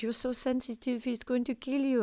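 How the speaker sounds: lightly voiced anxious mum; imitating mum. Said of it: "And my mum said".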